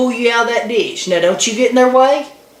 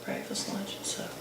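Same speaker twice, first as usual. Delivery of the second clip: whisper; 22 dB quieter than usual. Real, not acted. Repeating list under breath.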